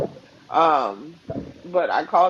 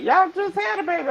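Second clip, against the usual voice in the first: much higher disbelieving mother; incredulous mom. Retold or imitated. Mother on the phone hearing the news, high and incredulous. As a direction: play mom who can't believe it.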